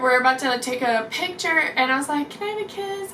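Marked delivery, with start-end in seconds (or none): 2.3-3.1 small, high, soft voice; hushed and pitched way up; timid request. Retold or imitated. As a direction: re-enact shyly asking an idol. Timid request to her idol.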